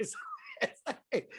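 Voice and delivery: laughing as he talks, slow and high; words squeezed out through laughter. Real, not acted.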